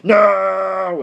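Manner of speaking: loud sharp "No!" shout; angry yell. Retold or imitated. Brother yelling at police: loud angry no. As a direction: yell it at the cops in fury.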